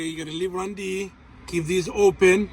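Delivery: ordinary talk, nothing marked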